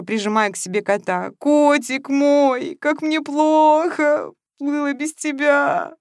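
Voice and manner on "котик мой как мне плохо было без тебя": slow, higher tearful wail. A narrator's reading. Masha wails to her cat how she missed it. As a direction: sob it out, clutching the cat. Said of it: "выла во весь голос".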